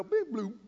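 short bing that drops into a dull bloop; clumsy cast noise falling flat; mock failed cast. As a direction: let the cast flop at the end. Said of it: "And then I'd pick it up and I'd go".

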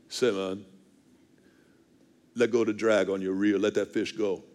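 low, laid-back imitation of the guide; fishing guide. Guide telling the angler to release the drag, low imitated voice. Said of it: "The guide came over to me and goes".

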